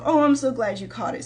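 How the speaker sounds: normal talking voice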